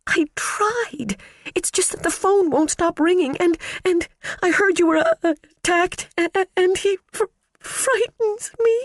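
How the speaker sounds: high, stammering, tearful voice; secretary breaking down to her boss, tearful; near tears. Acted. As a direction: stammer through rising tears. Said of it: "don't cry".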